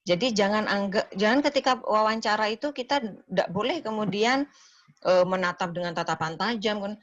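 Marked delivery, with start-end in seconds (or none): none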